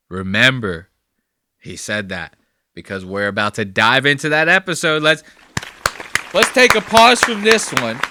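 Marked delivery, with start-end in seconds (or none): 5.0-8.0 loud, high announcer voice; big announcer call. Acted. Host loudly pausing the clip for the audience, announcer voice. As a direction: boom it out like a show host. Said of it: "dramatic pause announcement".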